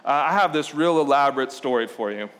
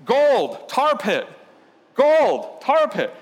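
higher and louder, calling out to shoppers; mock megaphone yell; 7 semitones higher, 4 dB louder, much slower than usual. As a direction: hawk it like a street crier. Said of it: "you yell at people".

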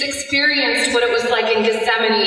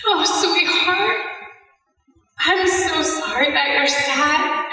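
tender motherly comfort; 4 semitones higher than usual. Retold or imitated. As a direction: console a heartbroken child. Mother comforting grieving son, retold.

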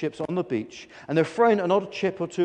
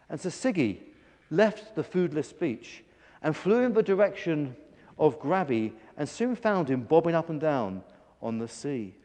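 usual speaking voice

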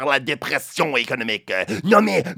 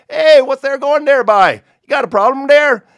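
loud, high Newfoundland call across the road; Newfie shout; 11 semitones higher, 8 dB louder than usual. Retold or imitated. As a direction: hail the stranger like a hearty Newfoundlander. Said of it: "avec ma meilleure imitation du merveilleusement charmant accent celtique".

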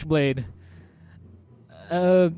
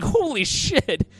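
slow, higher 'holy shit'; stunned reaction; 5 semitones higher, slower than usual. Retold or imitated. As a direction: imitate her amazement, stretching the words. Acted reaction to the switchblade.

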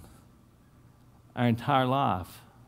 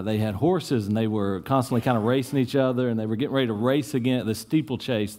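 ordinary talk

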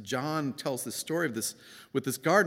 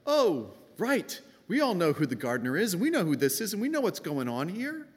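much higher, fast smug knowingness; smug knowing readers; 9 semitones higher, 3 dB louder than usual. Acted. Knowing Gospel readers shrug at the gardener scene, smug and high. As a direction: toss it off like you already know the ending. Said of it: "But it seems arrogant".

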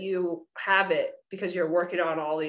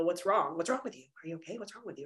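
hushed, much softer mock questioning; people prying; 2 semitones lower, 8 dB quieter, faster than usual. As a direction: quietly imitate people asking, fading away. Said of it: "people just wanna know".

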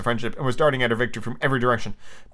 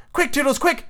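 high fairy squeal; screamed; 12 semitones higher than usual. A narrator's reading. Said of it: "she screamed".

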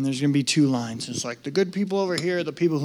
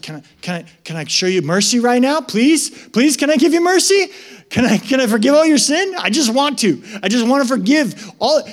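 higher, louder, eager begging voice; eager pleading God; 6 semitones higher, 5 dB louder than usual. Retold or imitated. God begging sinners, eager and louder. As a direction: plead to be allowed to forgive. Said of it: "He's just like".

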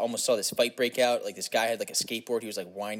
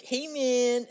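high hippie greeting; 13 semitones higher than usual. Acted. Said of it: "stereotypical hippie voice".

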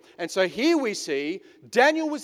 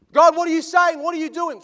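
mock anxious prayer; 9 semitones higher, 9 dB louder than usual. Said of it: "We want direction".

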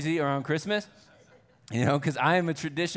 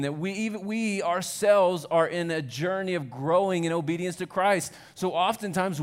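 higher, louder preaching voice; 5 semitones higher, 5 dB louder than usual. Acted. Pastor preaching to the congregation about broken relationships, louder. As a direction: drive the point home to the congregation.

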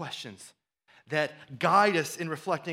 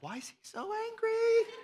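1 puzzled newcomer. Pretend. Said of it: "they're gonna be like".

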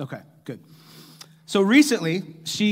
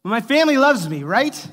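louder, higher plea to the room; loud appeal; 5 semitones higher, 7 dB louder than usual. Real, not acted. Pastor calling out to the congregation for reassurance, loud. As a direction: call it out for reassurance.

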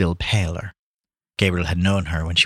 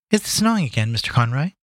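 high-pitched maid asking politely; Lily; 5 semitones higher than usual. Acted. Maid to guest in the pantry, high polite question. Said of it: "asked Lily".

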